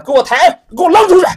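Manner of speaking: loud, high, fast shouted command; shouted order. Acted. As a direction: bark the order to throw them out. Manager orders them thrown out.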